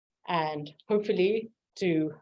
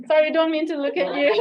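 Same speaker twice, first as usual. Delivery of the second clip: laughing; 8 semitones higher, 4 dB louder than usual. Real, not acted. Apologizing with a laugh.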